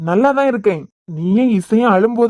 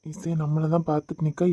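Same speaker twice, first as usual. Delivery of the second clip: hushed aside; 4 semitones lower, 9 dB quieter than usual. Acted. Hushed warning about watching daughter.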